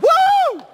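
very high loud woo; excited whoop. Acted. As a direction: let out a wild whoop. Climax of pounce description.

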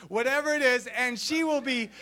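ordinary delivery

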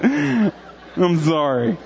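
very slow apology through real laughter; laughing apology. Real, not acted. Pastor apologizing to the congregation, still laughing. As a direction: apologize while still giggling.

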